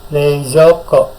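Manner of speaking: Reizouko drawn out slowly, each syllable; slow model word. Shown as a demonstration. Teacher models Reizouko slowly for students to copy. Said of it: "Dengarkan dulu".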